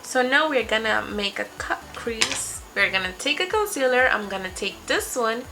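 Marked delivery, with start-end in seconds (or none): none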